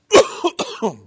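sharp real coughing fit; choking cough after swallowing a bug; hard cough. Real, not acted. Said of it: "I'm sitting here coughing".

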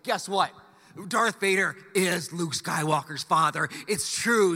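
ordinary delivery

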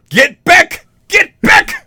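high loud panicked shout; frantic movie shout. Acted. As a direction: scream it like a horror hero. Quoting the film.

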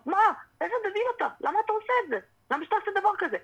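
high-pitched mock disbelief; exasperated spectator. Fighter mimics viewers judging a fighter's mistake, high mock exasperation. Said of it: "ואתה אומר".

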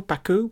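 "Baku" ending in "oo", high questioning lift; "Baku" said bah-koo, pitched high; questioned pronunciation. Shown as a demonstration. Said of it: "complaining about my pronunciation".

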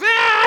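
very high, loud mock shriek; imitated child's scream. Stranger's kid screaming at a man, imitated shriek. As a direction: shriek like someone else's kid. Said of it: "somebody who's not my kid comes up to me and goes".

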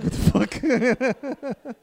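slow, high, loud, laughing; laughing disbelief. Retold or imitated. Flatmate over the curry bowl, laughing disbelief. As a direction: drag it out in bewildered laughter.

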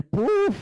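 a sharp high huff of air; high exasperated puff. Retold or imitated. Husband holding back a reply, high puff. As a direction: swallow the comeback with a puff. Swallowing his retort.

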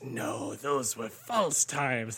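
slow, drawn-out tempter; slow sly depression voice. Retold or imitated. Depression telling him his happy times were false, slow and sly. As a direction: draw it out like a creeping wraith.

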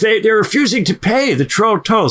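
high, silly troll whine; dopey cartoon bellow. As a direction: play a dopey indignant troll. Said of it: "the troll goes".

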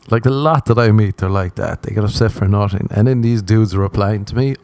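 normal talking voice

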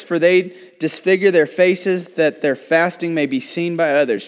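scripture reading. Acted. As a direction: read the Bible verse out to the congregation. Reads scripture aloud in sermon.

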